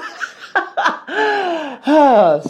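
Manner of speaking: high, loud burst of laughter; long high-pitched real laugh. Real, not acted. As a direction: crack up helplessly. Cracks up at own impression.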